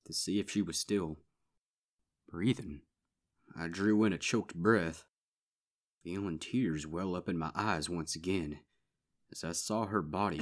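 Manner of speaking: plain reading voice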